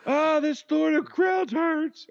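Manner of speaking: high, slow, drawn-out cry of pain; hammed-up victim wailing in pain; mock pained wail. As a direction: play agony, high and stretched. Joins acting the crucified victim.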